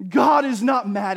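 God is not mad at you, belted out loud; a preacher's ringing declaration; preached shout. Real, not acted. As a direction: proclaim it full force to the room.

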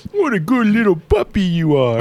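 high, sweet puppy talk; talking to a dog. Pretend. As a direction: coo at the puppy.

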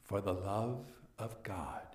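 Montresor's chilling reply; cold quiet echo. Acted. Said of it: "I said".

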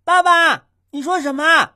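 high, loud-voiced child who can't hear; talking too loud. Child in earplugs calling to dad, talking too loud. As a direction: call out over blocked ears. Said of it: "难怪说话这么大声".

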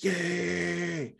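high, drawn-out cheer at normal volume; mock excited cheer. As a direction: imitate an excited "yay", stretched out. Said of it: "They're all excited".

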